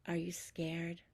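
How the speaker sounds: whispered. Real, not acted. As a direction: ask gently at the bedside.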